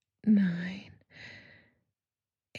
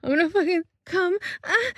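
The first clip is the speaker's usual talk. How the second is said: high, loud outburst; climactic cry; 9 semitones higher, 9 dB louder than usual. Pretend. Performer to a partner at the peak, loud cry. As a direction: cry it out, pitch leaping up. Acted climax, louder repeat.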